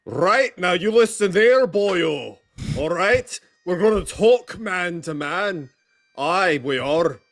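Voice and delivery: loud, Scottish-tinged Irish brogue, easing in volume; hammed-up Irish accent drifting into Scottish; mock Irish accent. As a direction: put on a man-to-man Irish brogue. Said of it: "doing a Scottish accent instead".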